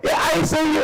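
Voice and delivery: hammed-up overeager hello; talkative greeter. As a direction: play the oblivious chatty friend. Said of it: "just like".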